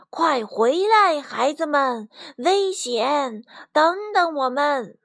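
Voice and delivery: calling out loudly. A narrator's reading. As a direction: call the youngsters back from danger. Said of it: "只好大声喊道". (焦急地大声呼喊)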